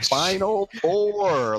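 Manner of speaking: high, drawn-out mock enunciation; imitating someone spelling it out for a machine; exaggerated slow enunciation. Mimics man repeatedly failing with Siri.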